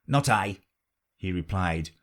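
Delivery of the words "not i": usual speaking voice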